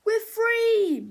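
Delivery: high, loud shout of relief; joyful cheer. Acted. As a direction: cheer at being set free. Freed people cheer.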